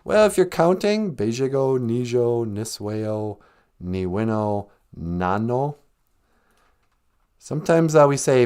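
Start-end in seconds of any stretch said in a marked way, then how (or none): none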